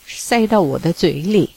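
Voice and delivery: usual speaking voice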